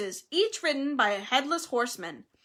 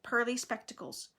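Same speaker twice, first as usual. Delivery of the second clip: plain reading voice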